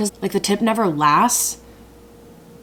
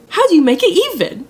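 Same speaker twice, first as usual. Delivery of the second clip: higher, louder, fast exasperated repeat; frustrated; 12 semitones higher, 8 dB louder, faster than usual. Real, not acted. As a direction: throw the question up in despair. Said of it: "I'm getting so frustrated".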